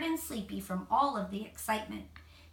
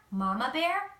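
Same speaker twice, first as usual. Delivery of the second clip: plain reading voice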